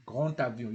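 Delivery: GRAND AVION joined by a T; D sounded as T. Shown as a demonstration. Teacher confirms how to say GRAND AVION, D as T. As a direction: link GRAND AVION with T, never D. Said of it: "That's how you say it".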